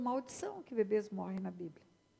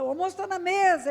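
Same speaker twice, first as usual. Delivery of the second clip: a parent calling out a bit higher and louder; 6 semitones higher, 7 dB louder than usual. Retold or imitated. Parent calls the son at the computer, mildly raised. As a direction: call the kid to lunch. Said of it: "E você fala".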